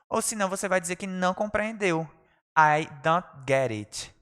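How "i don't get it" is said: slow, careful classroom English; drawn out so learners can copy; slow model phrase. Shown as a demonstration. Teacher modelling informal phrase, slowly. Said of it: "teacher models phrase slowly".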